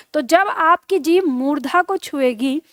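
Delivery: ordinary talk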